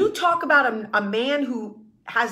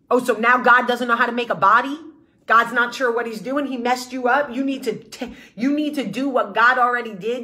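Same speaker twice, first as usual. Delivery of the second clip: usual speaking voice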